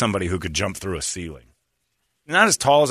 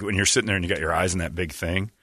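ordinary talk, nothing marked

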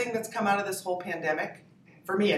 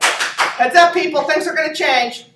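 high, loud grand proclamation; mock announcement; 10 semitones higher, 13 dB louder than usual. Comedian landing in Los Angeles, loudly announcing herself. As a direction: boom it out like a herald to the whole city.

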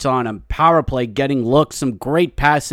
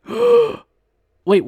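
surprised gasp. Real, not acted. Gasps at never seeing The Office.

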